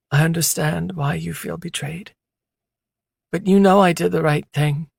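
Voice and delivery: a hurt woman standing her ground; woman's voice. A narrator's reading. Female character voiced by narrator.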